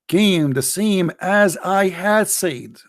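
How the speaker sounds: "came the same as I had said" dragged out; slow emphatic quote. Real, not acted. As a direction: spell it out slowly. Quoting the hadith slowly.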